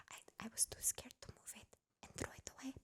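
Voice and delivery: hushed secretive whisper. Retold or imitated. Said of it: "whispers to me".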